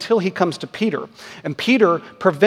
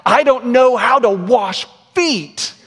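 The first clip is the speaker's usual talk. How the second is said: high, strained mock panic of a small boy; drawn-out, higher-pitched whisper-scream, hammed up; retold whisper-scream; 7 semitones higher, 4 dB louder, slower than usual. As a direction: imitate a frightened child's urgent stage scream. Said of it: "he whisper screamed to Alyssa and I".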